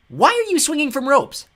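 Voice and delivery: high, drawn-out shouted question; loud demand. A narrator's reading. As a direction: demand an answer at full volume. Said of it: "she demanded".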